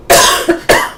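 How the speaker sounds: harsh cough; coughing fit. Real, not acted. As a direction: hack out a cough. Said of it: "I can barely speak".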